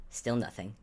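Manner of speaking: ordinary delivery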